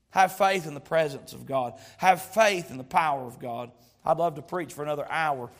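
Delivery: ordinary talk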